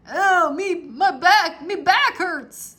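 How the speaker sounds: high, loud, slow moaning complaint; pirate moans about his aching back; complaining pirate. A narrator's reading. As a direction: groan about your back. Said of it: "Stinky cried".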